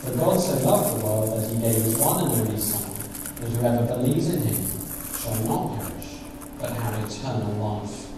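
soft, low reverent reading; quiet Bible reading voice; scripture reading. Acted. Reads scripture softly.

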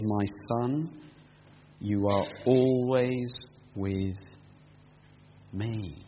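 slow, soft, lingering on always; tender fatherly reassurance. Retold or imitated. Father reassuring his older son, slow and tender. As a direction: hold the son close with the words. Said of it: "he says".

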